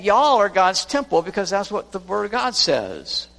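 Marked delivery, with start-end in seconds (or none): 0.0-1.2 proclaiming. Shown as a demonstration. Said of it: "I'm saying".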